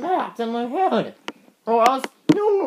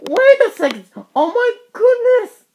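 shocked exclaim; 6 semitones higher than usual. Acted. Nurse shocked at the engine's injuries, crying out in alarm. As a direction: gasp it out in alarm.

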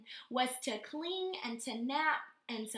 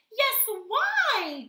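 loud, much higher calling out; 10 semitones higher, 9 dB louder than usual. Acted. Dingoes calling up to Kevin in the tree, loud and high. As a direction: shout up eagerly. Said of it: "called the Dingoes".

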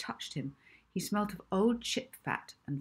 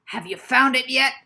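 loud, higher gruff professor voice; bully the librarian with the question; belligerent demand; 7 semitones higher, 13 dB louder than usual. Acted. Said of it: "he asked belligerently".

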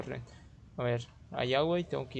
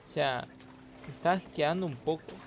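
usual speaking voice

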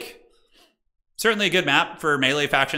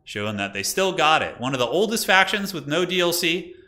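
ordinary talk

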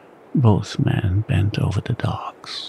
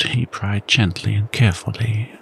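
usual speaking voice

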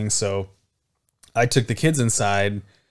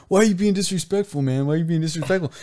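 put-on higher-pitched, whiny drunk protest; aggrieved drunk; 7 semitones higher, faster than usual. Drunk man protesting to the neighbor who ordered him off, aggrieved. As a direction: plead your case like an offended drunk. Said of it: "And the guy was like".

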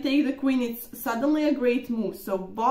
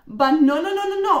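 louder and higher, insistent denial; emphatic no; 5 semitones higher, 6 dB louder than usual. Real, not acted. Player insists to viewers she never gave up a pawn, loud and insistent. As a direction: shoot the idea down hard, over and over. Rejecting the coach's verdict.

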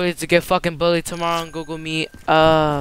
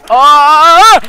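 a loud, high yelp of shock; startled shout; 8 semitones higher, 12 dB louder than usual. Real, not acted. Gamer yelling Oh when something hits him. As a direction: jolt out a loud Oh.

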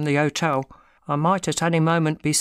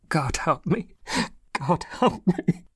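despairing plea; slower than usual. A narrator's reading. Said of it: "burst into convulsive sobbing".

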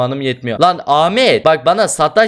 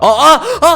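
shocked cry; 12 semitones higher, 5 dB louder than usual. Real, not acted. Killed by sword, shocked cry.

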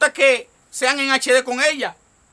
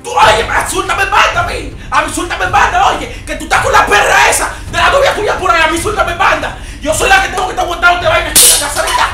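yelling wife. Acted. Dominican wife yelling at husband.

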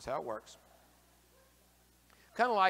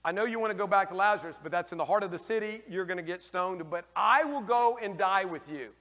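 loud, higher-pitched earnest plea; Thomas pleading; 4 semitones higher, 8 dB louder than usual. Acted. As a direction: voice Thomas begging to go with him, loud. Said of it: "it is Thomas who says".

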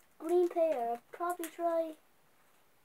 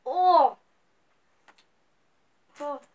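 pained sour groan; sour candy reaction; 8 dB louder than usual. Real, not acted. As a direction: wince at the sourness.

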